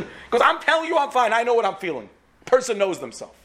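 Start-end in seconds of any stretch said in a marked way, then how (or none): 0.4-2.1 insisting he's fine. Acted. Drunk husband insisting he's fine.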